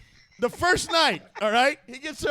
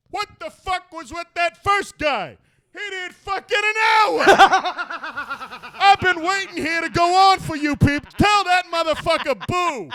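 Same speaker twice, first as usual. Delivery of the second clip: higher, louder angry rant; laughing imitation of a star ranting at the crowd; mock heckling shout; 5 semitones higher, 5 dB louder than usual. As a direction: whip the crowd up against the opener. Quoting Dice onstage.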